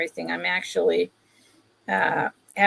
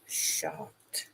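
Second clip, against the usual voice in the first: whispered; 14 dB quieter than usual. Real, not acted. Telling how the item surprised her.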